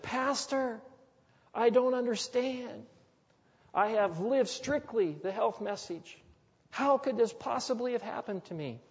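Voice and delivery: high, pleading voice of the sick lady; sick woman pleading. Retold or imitated. Pastor retells the sick lady's plea, high and pleading. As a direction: lifts his voice high to play the bewildered patient.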